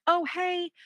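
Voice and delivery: high-pitched acted hello; chirped, put-on hello; friendly greeting. Acted greeting to a new neighbor.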